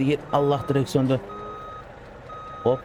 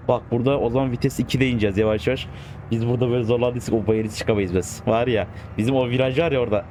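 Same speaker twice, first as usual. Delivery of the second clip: ordinary talk, nothing marked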